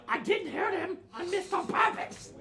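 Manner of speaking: very high, loud goblin protest; defensive goblin. Acted. Goblin denying he shot someone, defensive squeal. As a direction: squeal your excuse defensively.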